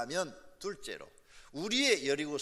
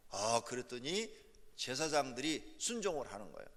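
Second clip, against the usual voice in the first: ordinary delivery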